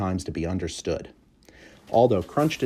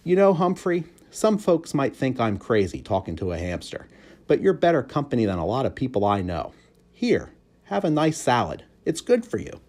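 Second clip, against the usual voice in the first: cheerful janitor; 6 semitones higher than usual. A narrator's reading. Aldo's voice.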